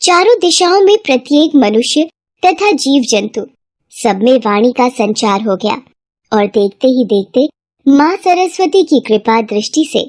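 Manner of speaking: plain reading voice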